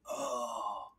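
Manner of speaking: soft, slow, dazed; dazed murmur. Retold or imitated. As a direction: mumble as if drugged into numbness. Drugged baby resigned.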